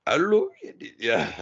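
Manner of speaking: put-on Indian accent. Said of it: "I can't even do my, my Indian voice".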